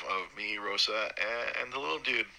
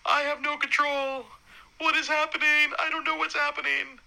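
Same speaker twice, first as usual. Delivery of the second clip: retold panic. New dad retells his panic in the delivery room, high frantic voice. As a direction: squeak it out like you've lost control. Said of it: "I'm like".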